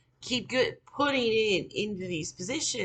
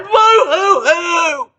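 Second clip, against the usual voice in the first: loud, high, drawn-out fake boo-hoo; mock crying; 14 semitones higher, 15 dB louder, much slower than usual. Mimicking people who cry all day, loud mocking wail. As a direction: wail it like an exaggerated crybaby. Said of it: "they spend the whole day crying".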